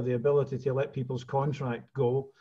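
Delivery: usual speaking voice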